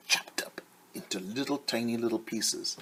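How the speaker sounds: hushed, secretive whisper; storyteller's whisper to the kids. Acted. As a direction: lean in and breathe it like a secret. Teacher hushes for effect.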